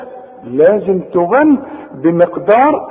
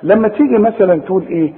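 ordinary talk, nothing marked

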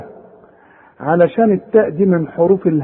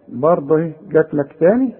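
ordinary talk, nothing marked

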